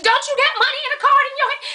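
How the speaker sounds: high, fast disbelieving snap; incredulous high retort. Retold or imitated. Customer snaps at the server holding her card, high and fast. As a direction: fire it back in disbelief. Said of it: "I said".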